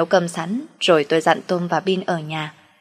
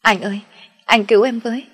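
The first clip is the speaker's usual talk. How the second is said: higher-pitched, imploring cry for help; tearful plea; 5 semitones higher than usual. A narrator's reading. Woman begging her ex on the phone, tearful plea. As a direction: plead as if in tears. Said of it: "Tiếng chị An khóc nức nở".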